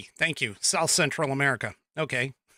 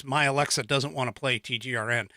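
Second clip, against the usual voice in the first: usual speaking voice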